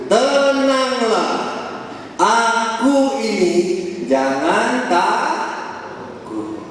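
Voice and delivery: very slow, drawn-out calming words; slow reassurance. Acted. Jesus calms the terrified disciples on the lake, slowly and gently. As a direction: say it slowly to calm frightened men. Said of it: "ia berkata kepada mereka".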